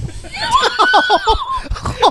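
high shrieking laugh. Real, not acted. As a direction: burst into a high, helpless howl. Friend choking on beer, uproar.

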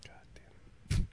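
slow, hushed curse under the breath; real under-the-breath exasperation; exasperated whisper. Real, not acted. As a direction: whisper it in defeat. Losing the argument about the clock.

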